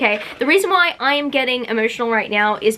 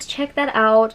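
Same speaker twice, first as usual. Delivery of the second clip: ordinary delivery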